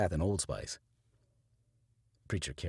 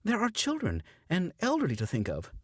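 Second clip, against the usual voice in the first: much higher, louder plea; pleading older woman; 13 semitones higher, 5 dB louder than usual. A narrator's reading. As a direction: beg him to see reason. Brenda pleading with preacher.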